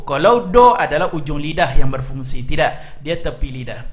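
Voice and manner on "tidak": normal talking voice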